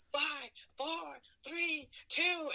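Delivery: high, sing-song stage countdown; mock countdown. Floor manager counts down before Goldberg's entrance, high mock countdown.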